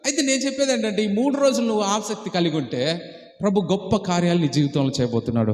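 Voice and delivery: ordinary delivery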